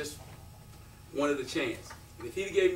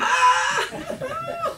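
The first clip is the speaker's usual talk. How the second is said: loud, high whoop; joyful scream; 8 dB louder than usual. Retold or imitated. Kid told the coach really wants him, re-enacted high scream. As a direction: scream as if you just got the offer. Retells joy at coach's offer.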